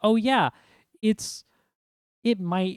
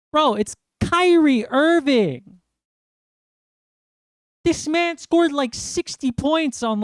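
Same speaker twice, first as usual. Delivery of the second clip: much higher and louder, incredulous ranting at the front office; exasperated rant; 6 semitones higher, 3 dB louder than usual. Real, not acted. Fan yelling at Nets management over Kyrie, high incredulous rant. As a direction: fire off disbelieving questions at the Nets.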